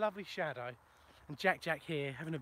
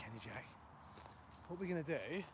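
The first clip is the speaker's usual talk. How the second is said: usual speaking voice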